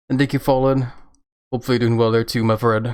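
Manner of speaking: normal talking voice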